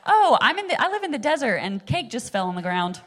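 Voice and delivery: high, loud sarcastic complaining; mock disbelief. Teacher mocks Israelites with sarcastic complaining. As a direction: sneer at the silly grumble.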